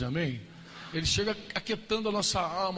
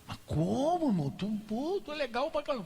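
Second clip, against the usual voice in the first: higher, protesting friend; surprised brother; 9 semitones higher than usual. Retold or imitated. Brother protests Franco's apology.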